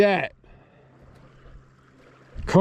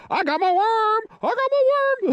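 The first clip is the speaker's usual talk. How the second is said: gleeful squeal. Real, not acted. Delighted at getting worm back.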